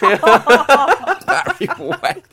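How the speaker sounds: laughing. Real, not acted. Laughing at the band name.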